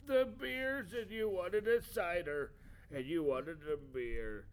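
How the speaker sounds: high, loud cheery voice; high put-on voice. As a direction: bounce in with the drinks, high and loud. Returning with drinks, put-on voice.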